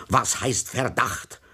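slow, a touch irritated retort. A narrator's reading. Exasperated victim retorts to the inspector, mildly irritated. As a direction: push the words out in mild irritation. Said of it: "brachte er gereizt hervor".

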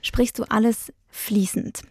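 ordinary delivery

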